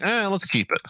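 playful voice of a studio giving in; imagined studio shrug. Pretend. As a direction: toss it off high, mock-executive. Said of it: "So maybe W B will be like".

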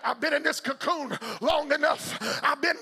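high gospel shout climbing phrase by phrase; full-force preaching. Acted. Preacher cries out as the believer stuck in the cocoon, shouted preaching.

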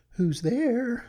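put-on high, old granny call from the bed; grandmother calling out. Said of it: "called the grandmother".

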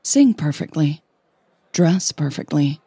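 plain reading voice

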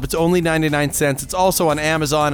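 normal talking voice

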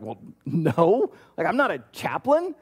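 imitating a startled refusal; inner panic. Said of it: "Deb internally freaks out".

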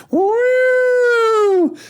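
long, high-pitched getaway "woo"; sustained siren-like falsetto whoop; high drawn-out whoop. Acted. Fireman running away with squirrel on Jacob's lap, whooping.

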